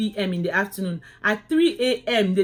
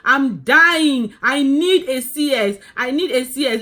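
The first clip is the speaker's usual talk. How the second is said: pleading cries, pitched higher and louder; a replayed labor-ward scream of begging, high and loud; desperate shouting; 5 semitones higher, 5 dB louder than usual. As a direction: beg for a C-section at the top of your voice, mid-contraction. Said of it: "I was just shouting".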